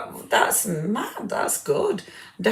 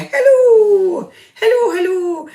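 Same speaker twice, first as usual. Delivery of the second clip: high, loud, bright hello called out; sing-song greeting; 6 dB louder than usual. Real, not acted. Host calling out a joyful hello to a new viewer. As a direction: welcome a friend who just joined.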